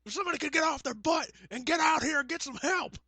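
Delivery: very high, frantic commentator plea; hammed-up ringside panic, begging for help; Jim Ross. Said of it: "Jim Ross impression".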